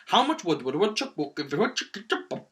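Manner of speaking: racing and tumbling over the words; botched quick tongue twister. Shown as a demonstration. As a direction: rush the tongue twister until it falls apart.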